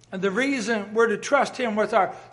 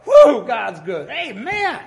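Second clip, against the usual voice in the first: ecstatic praise; 3 semitones higher, 9 dB louder, slower than usual. Retold or imitated. Praying man bursting with joy at God's goodness, exuberant whoop. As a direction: burst out in praise like it's too good to hold in. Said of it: "you go".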